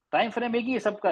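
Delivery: ordinary delivery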